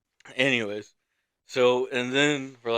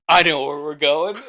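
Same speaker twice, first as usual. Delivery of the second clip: loud, raised burst of excitement; excited; 4 semitones higher, 11 dB louder than usual. Retold or imitated. Friend pops up in the back seat, loud and excited. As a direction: blurt it out loudly, imitating an excited drunk friend. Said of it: "he was excited".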